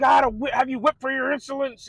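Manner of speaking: mock royal rebuke of a disobedient warrior; upset king. Agamemnon scolds Achilles for his insolence, mock angry scolding. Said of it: "Agamemnon's upset".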